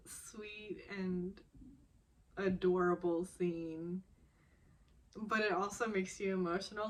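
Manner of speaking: normal talking voice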